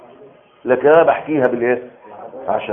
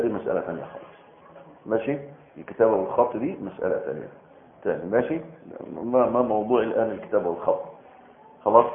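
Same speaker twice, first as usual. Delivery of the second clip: softer, off-topic side remark to one student; quiet aside; 4 semitones lower, 9 dB quieter than usual. Real, not acted. Lecturer quietly brushing off a student's off-topic question, soft aside. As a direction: murmur it aside, keeping the lecture on track.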